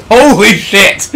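loud, slow stunned exclamation; surprised shout. Real, not acted. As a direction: blurt it out in shock. Surprised in game.

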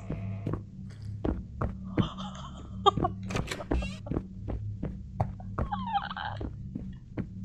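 breathless laugh. Real, not acted.

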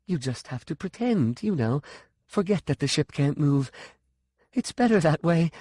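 put-on anxious, childlike confession; young, frightened. A narrator's reading. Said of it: "Her voice sounded young, frightened".

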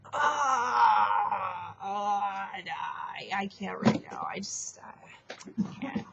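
straining cry fading into a whisper; mock strained cry. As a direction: fake the effort, then whisper the failure. Clay straining, failing at lava.

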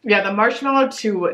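words muffled by food; chewing while talking. Real, not acted. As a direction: mouth partly full of candy. Seen 0: Right speaker bit the bar just before; cheeks still working early on.

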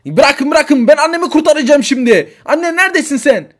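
put-on high, loud kid calling out; a desperate little boy hunting for mom; frantic child. Child Arda searching the station for his mother, frantic.

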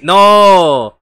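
un "¡no!" fuerte y sorprendido; suéltalo como si fallara el movimiento; grito de sorpresa. Real, not acted. Reacts to a botched spot. (grito de sorpresa)

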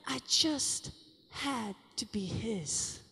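soft, tender. Real, not acted. Climax of testimony, falls soft.

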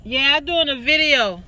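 loud, high call across the yard. Real, not acted. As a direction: shout over to your sister outside. Calling out to sister outside.

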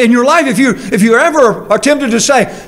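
ordinary talk

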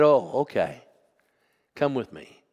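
ordinary delivery

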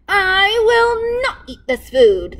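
high, loud, slow bratty refusal; stubborn king. A narrator's reading. Spoilt king refusing the cook's pizza, high slow wail.

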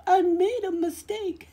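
high, scared apology; plead, still shaking; sputtering. Acted. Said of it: "he sputtered".

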